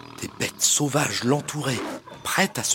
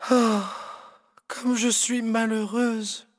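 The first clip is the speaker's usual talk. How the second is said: sighing; 9 semitones higher, slower than usual. Acted.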